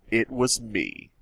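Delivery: usual speaking voice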